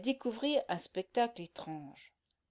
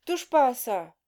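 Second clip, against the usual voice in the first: gruff soldier; 2 semitones higher, 6 dB louder than usual. A narrator's reading. Soldier warns her off.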